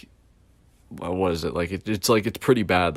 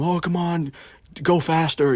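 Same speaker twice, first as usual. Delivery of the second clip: higher-pitched imitation of a nagging manager; mock manager yelling; 10 semitones higher, 3 dB louder than usual. Manager hurrying a worker along, mock yelling. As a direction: yell it impatiently, as the retold manager. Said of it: "Manager always yelling at him".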